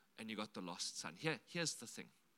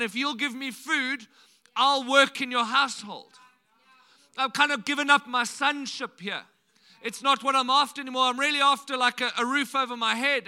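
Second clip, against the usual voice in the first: 1 rehearsed speech to dad; 3 semitones higher, 8 dB louder than usual. Retold or imitated. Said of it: "this little speech prepared".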